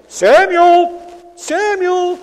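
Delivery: loud, high call across a dark room; shouted summons into the night. Acted. God calling the boy Samuel.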